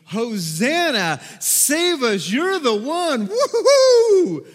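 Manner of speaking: high, loud, slow hosannas ending in whoops; excited crowd cheering. Acted. Crowd at the city gate cheering Jesus, loud and high. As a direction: hail him as the king.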